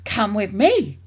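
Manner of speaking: loud, urgent command; yell. A narrator's reading. Mister Cranky grabbing George by the arm, yelling. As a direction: yell it as an order to follow at once. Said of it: "Mister Cranky yelled".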